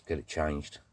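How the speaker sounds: ordinary delivery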